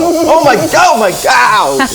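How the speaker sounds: high, loud shocked gasp of words; horrified but amused outcry; shocked exclamation. Real, not acted. Reacting to gory makeup.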